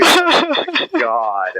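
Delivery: drawn-out, very high gasp of amazement; amazed oh my god. Real, not acted. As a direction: stretch it slow and high, delighted disbelief. Amazed at the yelling episodes.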